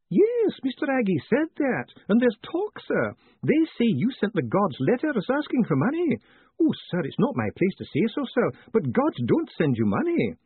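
high-voiced, fussy old-retainer clerk; Miss McAlariat, doubtful. Clerk doubting the gods will send Moist money, high voice. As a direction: object respectfully in a high character voice.

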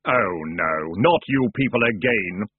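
sepulchral, dismayed ferryman of the dead; dark hooded figure's dread voice; voice felt, not heard. A narrator's reading. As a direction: intone it like a weary spectre. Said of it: "voice that was not so much heard as felt".

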